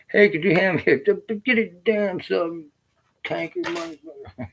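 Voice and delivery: high whiny put-on voice of a tanker who won't get off; mocking a lazy tanker. Ex-scout mocks lazy tankers asking for things, high whiny voice. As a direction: whine it like a pampered tanker.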